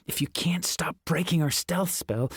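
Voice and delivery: unvoiced whisper through clenched teeth; hissed whisper. A narrator's reading. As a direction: hiss it, voice held down. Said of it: "I hissed through clenched teeth".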